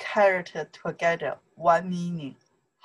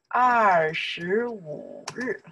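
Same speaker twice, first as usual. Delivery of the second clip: very slow, swooping held syllables; drawn-out demonstration; much slower than usual. Shown as a demonstration. Teacher modelling the date in Chinese, very slowly. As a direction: stretch every syllable of the date.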